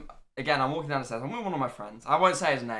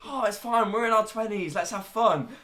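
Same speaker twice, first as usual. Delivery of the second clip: lightly hyped, higher-pitched quote; retold breezy enthusiasm; 7 semitones higher than usual. Telling friends about before the stream, mock breezy enthusiasm. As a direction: imitate your own carefree pep.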